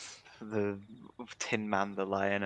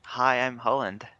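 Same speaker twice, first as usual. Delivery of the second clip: ordinary delivery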